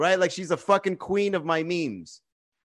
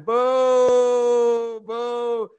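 long raised crowd boo; mock booing; 6 semitones higher, 6 dB louder than usual. Audience boos the comic leaving the stage, jeering. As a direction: boo loudly, holding each one out.

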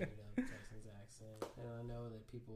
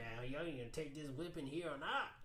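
higher mock Southern scolding; Southern accent; 4 semitones higher than usual. Acting a Southerner threatening a whipping, Southern accent. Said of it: "a little Southern talk to it".

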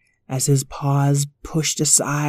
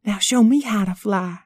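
puppy voices; 6 semitones higher than usual. Acted. Puppies chat about flying.

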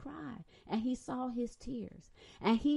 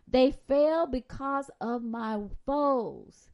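ordinary delivery

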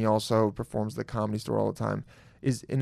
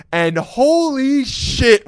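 ordinary delivery

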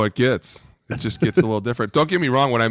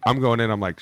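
usual speaking voice

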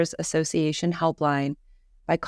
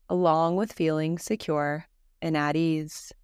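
calm, even voice with long pauses; guided meditation. Acted. Leading listeners through Yoga Nidra practice, guided meditation voice. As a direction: gently guide the listener into rest, leaving space between phrases.

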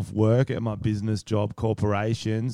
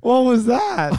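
high, startled what was that; baffled question; 14 semitones higher, 6 dB louder, slower than usual. Real, not acted. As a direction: ask in disbelief, pitch jumping up.

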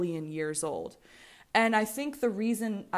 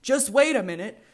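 revving up; 3 semitones higher, 8 dB louder than usual. Real, not acted. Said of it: "my women's empowerment rant".

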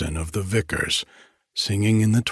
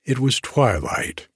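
usual speaking voice

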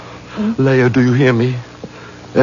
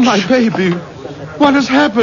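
alarmed; 10 semitones higher than usual. Acted. Father sees daughter carried in.

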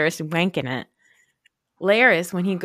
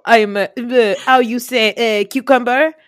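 hammed-up foreign accent, loud and high; halting, put-on foreign accent, groping loudly for words; Mysaria's bad accent; 6 semitones higher, 11 dB louder, slower than usual. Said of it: "Mysaria and her bad accent".

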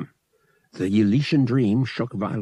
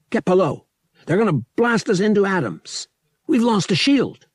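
urgent orders; 9 semitones higher, 4 dB louder than usual. Acted. Han ordering Murg during attack.

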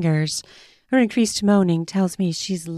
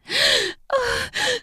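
long high gasp. Acted. Woman gasps.

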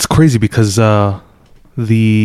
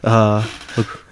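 ordinary talk

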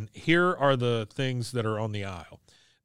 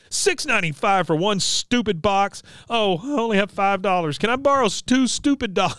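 put-on upset teen girl; 8 semitones higher, 3 dB louder than usual. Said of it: "and she goes".

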